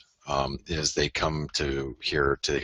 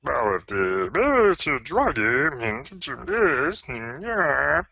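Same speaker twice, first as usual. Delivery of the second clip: high, slow squeaky puppet frog voice; Kermit the Frog voice; 10 semitones higher, 4 dB louder, slower than usual. Acted. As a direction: do a goofy cartoon frog, slowly. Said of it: "channeling your inner Kermit the Frog".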